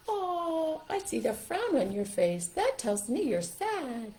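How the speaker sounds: high, slow sympathetic puppet; high, drawn-out concerned animal voice; Softy. Shown as a demonstration. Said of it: "here comes Softy. This is Softy".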